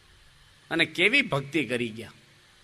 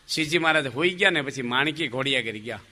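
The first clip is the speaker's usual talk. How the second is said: ordinary talk, nothing marked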